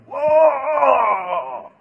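high loud cry of astonishment; amazed whoa. Acted. Servant seeing fiery chariots, loud amazed cry. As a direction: gasp it out at a sudden sight. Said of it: "and he goes".

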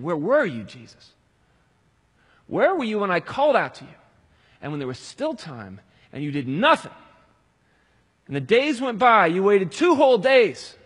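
loud, high, crying out to God; impassioned preaching. Acted. Preacher crying out to the Lord in grief, full force. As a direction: build the lament to a shout.

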